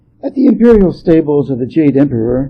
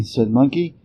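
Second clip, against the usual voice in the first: usual speaking voice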